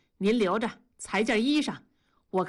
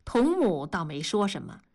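ordinary delivery, nothing marked

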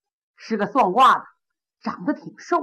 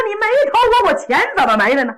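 angry retort; 8 semitones higher, 3 dB louder, faster than usual. Acted. Cousins quarrel over the money.

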